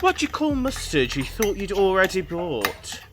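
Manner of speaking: ordinary talk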